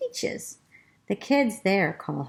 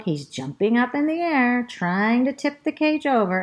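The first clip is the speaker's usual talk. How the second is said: plain reading voice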